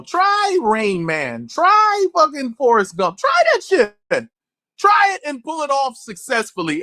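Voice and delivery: ordinary delivery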